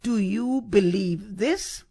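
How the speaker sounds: slow question rising onto "this"; stress on THIS. Shown as a demonstration. Preacher tries a third reading of Jesus' question, stress on THIS. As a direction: set "this" apart and lift it. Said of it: "the emphasis on this".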